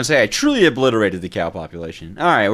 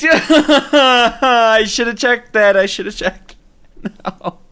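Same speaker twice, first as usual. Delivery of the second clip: slow, giggling regret; laughing; 12 semitones higher, 7 dB louder, slower than usual. Real, not acted. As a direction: laugh through the words. Laughing at own mistake.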